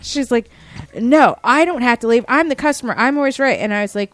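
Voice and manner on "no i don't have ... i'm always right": a bit louder, higher mock indignant customer; imitated yelling customer. Quoting the customer refusing to leave, mock shout. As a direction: play the entitled customer, raised and higher. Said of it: "she started yelling at me".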